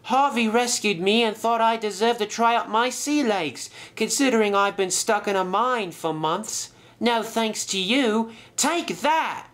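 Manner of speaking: high-pitched, feisty character voice; spirited little boat. Acted. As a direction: retort triumphantly, building to a high attack cry. Skiff answers the villain.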